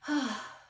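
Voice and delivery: big exhaled sigh, re-enacted; relieved sigh. Daughter leaving the shop thanking mom, relieved sigh. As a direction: act out letting it all go in one breath. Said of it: "and was like".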